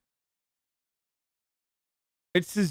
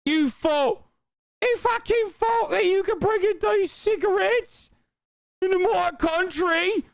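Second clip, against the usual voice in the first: high put-on British geezer voice, aggressive; mock customs officer; 12 semitones higher than usual. Mocking the officer who busts the couple, high put-on British voice. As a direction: lampoon the officer as a shouty London bloke.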